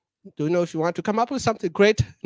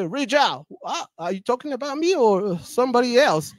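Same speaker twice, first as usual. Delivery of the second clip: confused at own name; 6 semitones higher than usual. Retold or imitated. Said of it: "I can barely understand my name".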